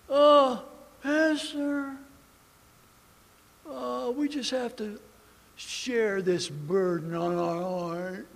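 slow, heavy-hearted put-on piety; a hint of a pious complainer; mock solemn member. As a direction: feign a heavy burden.

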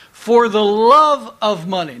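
"love" swelled long and strong; stress on "love". Shown as a demonstration. Preacher sets love of money against money, stressing "love". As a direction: lean heavily on "love", not on "money". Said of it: "not money".

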